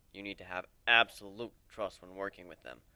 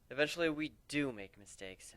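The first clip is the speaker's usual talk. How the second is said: plain reading voice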